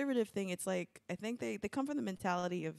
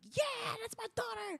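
high excited cheer; a proud parent whooping from the crowd; imagined parent cheering for daughter, high and excited; mock cheering; slower than usual.

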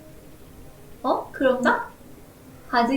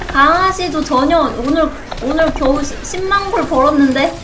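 exasperated; 3 semitones higher, 7 dB louder than usual. Real, not acted. Complaining about low earnings.

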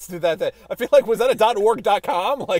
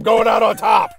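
triumphant shout; 3 semitones higher, 7 dB louder than usual. Pretend. Mock coach quitting after one game.

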